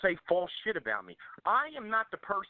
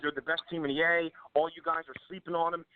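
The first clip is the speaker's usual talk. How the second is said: usual speaking voice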